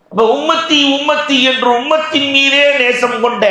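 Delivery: high, sustained oratorical proclamation; preaching. Real, not acted. Preacher proclaims love for the community, preaching. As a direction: lift the voice and declaim to the crowd.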